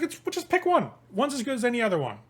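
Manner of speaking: high, flippant brush-off, hustler voice; Jason dismissive. Acted. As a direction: toss it off high and careless.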